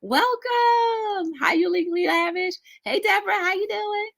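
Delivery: high, sing-song hellos; bubbly, high-pitched shout-out; excited welcome. Real, not acted. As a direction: call out greetings way up high. Calling out greetings to chat.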